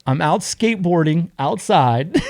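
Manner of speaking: ordinary talk, nothing marked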